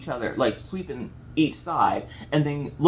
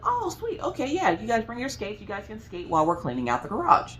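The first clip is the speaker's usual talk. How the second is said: excited chirpy yes; 7 semitones higher than usual. Retold or imitated. Mom cheerfully answering the kids.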